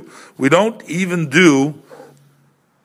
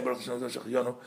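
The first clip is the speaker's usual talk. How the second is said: congregant's blessing, murmured much softer; undertone; 15 dB quieter, much faster than usual. Shown as a demonstration. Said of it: "each one says it, uh, quietly".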